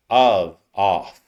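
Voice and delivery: "Of" with a v sound, "off" with an f and open vowel; "Of" voiced, "off" open ah; of versus off. Shown as a demonstration. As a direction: contrast "Of" and "off" clearly. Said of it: "very different".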